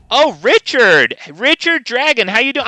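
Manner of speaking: high, loud delighted hello; light up at a familiar name; excited greeting. Real, not acted. Streamer spotting Richard in chat, excited greeting.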